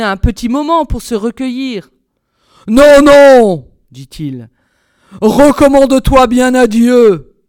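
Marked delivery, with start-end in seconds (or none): 2.7-3.7 booming roar of refusal; loud raging tyrant; furious refusal. A narrator's reading. Bluebeard refuses plea, blade raised. 5.2-7.4 slow, booming threat; loud raging executioner; merciless command. A narrator's reading. Bluebeard about to strike wife.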